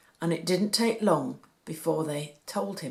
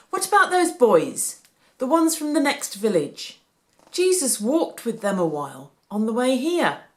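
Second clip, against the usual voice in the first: higher, louder with a new hope; sudden idea; 5 semitones higher, 7 dB louder than usual. A narrator's reading. Joseph suggests asking the village boys, suddenly hopeful. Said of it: "Joseph snapped his fingers".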